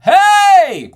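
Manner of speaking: high, loud, sustained call; full-voiced holler; belted yell. Shown as a demonstration. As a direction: yell it out with a belt. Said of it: "a need to yell".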